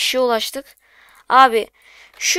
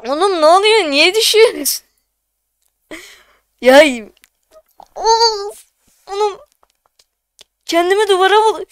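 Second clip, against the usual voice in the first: high-pitched, frustrated wailing complaint; exasperated despair; 7 semitones higher than usual. Real, not acted. Streamer watching the count keep falling, exasperated. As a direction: groan in disbelief as it keeps dropping.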